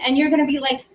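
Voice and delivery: usual speaking voice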